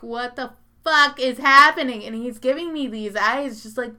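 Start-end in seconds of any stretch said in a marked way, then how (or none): 0.0-2.0 loud, incredulous mock yell; mock disbelief. Friend among dancing women, loud disbelief. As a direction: play the friend's stunned outburst. Friend stunned on dance floor.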